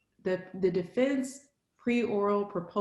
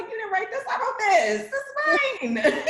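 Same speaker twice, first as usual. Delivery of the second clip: high, proud, cocky claim; 4 dB louder than usual. Shown as a demonstration. To the committee, claiming the dissertation, cocky. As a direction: own your work, cocky and proud. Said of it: "be cocky with it".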